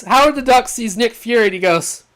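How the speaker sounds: usual speaking voice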